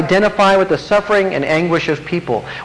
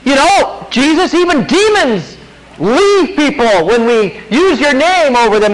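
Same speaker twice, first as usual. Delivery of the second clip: higher, eager report of miracles; excited disciples; 5 semitones higher than usual. Retold or imitated. Disciples excitedly telling Jesus about casting out demons, eager voice. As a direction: gush the good news like a thrilled disciple.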